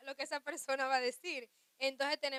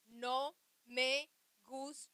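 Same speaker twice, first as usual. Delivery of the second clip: slow and unhurried, a mild demo of calm speech; slow, gentle complaint shown as the right way; gentle low tone; 3 dB louder, much slower than usual. Shown as a demonstration. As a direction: say it calmly and slowly instead of getting angry. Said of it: "el tono de voz más bajito para decir".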